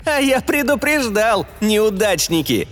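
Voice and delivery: high, taunting I-told-you-so; gloating AI. A narrator's reading. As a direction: jeer at the losers. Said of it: "мерзко захихикал".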